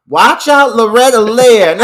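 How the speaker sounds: high, loud hammed-up brag; mock boastful shout. The two singing girls boasting of themselves, mock proud shout. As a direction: crow it loudly as an imitated boast.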